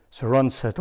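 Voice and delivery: ordinary talk, nothing marked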